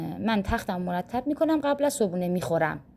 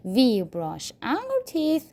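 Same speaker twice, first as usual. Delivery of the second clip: slow model sentence; 4 semitones higher, much slower than usual. Shown as a demonstration. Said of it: "building sentence".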